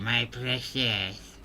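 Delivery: breathy my precious, drawn out; Gollum voice. Pretend. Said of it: "rate my Golem voice".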